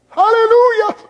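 high, loud sustained hallelujah; shouted praise; joyful cry. Real, not acted. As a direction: ring it out high. Preacher exclaims in worship.

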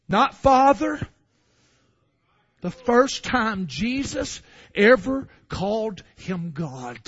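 ordinary talk, nothing marked

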